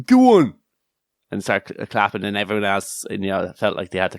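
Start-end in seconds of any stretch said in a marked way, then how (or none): 0.0-0.5 high, loud mocking "Go on"; loud jeering call; crowd call. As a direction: shout it like a crowd. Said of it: "twenty or thirty people going".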